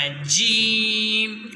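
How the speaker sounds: put-on teacher slowly shows جیم for the child to copy; drawn-out jeem. Shown as a demonstration. Teacher demonstrates جیم slowly and clearly. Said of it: "آپ نے جیم کو ادا کرنا".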